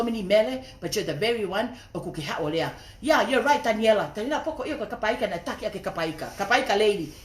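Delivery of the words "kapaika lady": normal talking voice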